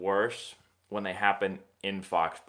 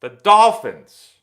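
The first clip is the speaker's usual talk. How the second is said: much louder, slow disgusted groan; 4 semitones higher, 15 dB louder, much slower than usual. Real, not acted. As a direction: spit the name out in disgust. Groaning name of hated team.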